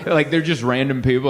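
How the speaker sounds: ordinary talk, nothing marked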